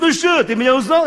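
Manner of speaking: высокий, громкий голос старого друга; допытываться громко и высоко; напористый расспрос. Retold or imitated. Old friend quizzes him excitedly. (напористо, почти криком)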